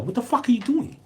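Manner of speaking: very high-pitched incredulous question; mock outcry, voice jumping right up; retold alarmed challenge. Soldier challenging a Yank racking his rifle, retold alarm.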